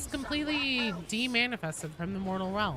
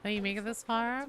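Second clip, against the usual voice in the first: normal talking voice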